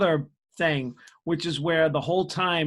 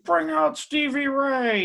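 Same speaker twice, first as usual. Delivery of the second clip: crowd yell; 11 semitones higher, 3 dB louder, slower than usual. Retold or imitated. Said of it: "It's like".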